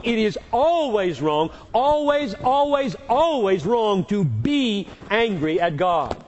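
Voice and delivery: "always" hammered high and loud, repeated; preacher's insistence on "always"; emphatic always. Real, not acted. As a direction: pound "always" like a verdict, slowing on the last line. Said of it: "when I put the emphasis on the first one".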